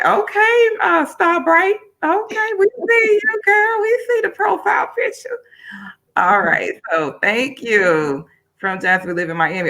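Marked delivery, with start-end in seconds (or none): 0.1-5.4 high excited greeting to a friend; excited squeal. Real, not acted. Host greeting a commenter by name, high and excited.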